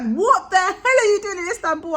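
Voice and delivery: high, loud, laughing disbelief; shocked mom. Retold or imitated. Mom hearing her daughter is in Istanbul, high laughing disbelief.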